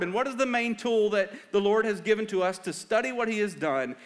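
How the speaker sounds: high, forceful sermon voice; impassioned preaching. Real, not acted. Preaches with raised, urgent force.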